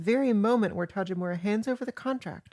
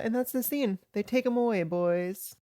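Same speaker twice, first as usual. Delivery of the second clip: ordinary delivery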